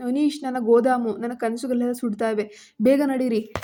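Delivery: plain reading voice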